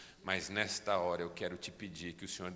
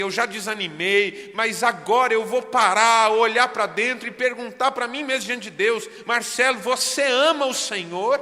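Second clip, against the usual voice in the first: rising to a full, much higher shouting climax; fiery preaching; 11 semitones higher, 16 dB louder than usual. Acted. Preacher to his church, confessing his failures, building to a roaring shout.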